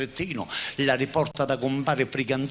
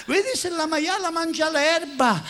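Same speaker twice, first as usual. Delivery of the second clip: calling out; slower than usual. Acted. Husband calls out again.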